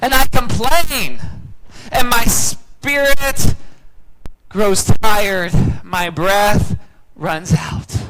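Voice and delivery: loud, high, slowed cry stretching each word; anguished lament. Acted. Preacher voices the weary psalmist, loud and drawn out. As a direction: let the lament peak then run out of breath. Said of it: "So imagine how this psalm feels".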